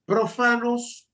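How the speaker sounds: profanos drawn out slow and high; emphatic. Real, not acted. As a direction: lean hard on profanos. Preacher stressing a key word.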